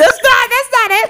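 high, slow, failing Irish accent; strained Irish accent. Shown as a demonstration. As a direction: push the Irish accent until it cracks. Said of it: "I might have to drop this Irish accent".

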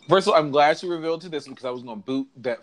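loud laughing rant. Real, not acted. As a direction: laugh through the dig. Laughing rant about rap.

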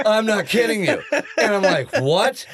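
high, laughing astonishment; laughing disbelief. Real, not acted.

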